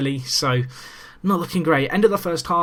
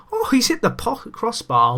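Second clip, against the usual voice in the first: a sudden much higher gasp of shock; surprised shriek; 9 semitones higher than usual. Real, not acted. Watching his shot strike the crossbar, real high surprise. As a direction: yelp as it hits the bar.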